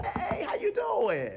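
high, drawn-out mock-friendly hello; trouble's jaunty greeting. Trouble drops in on a churchgoer, mock-friendly greeting. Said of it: "Now trouble said".

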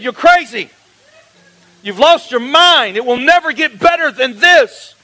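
an annoyed lawyer losing patience; exasperated scolding. Retold or imitated. Lawyer scolding her stubborn client, exasperated and loud.

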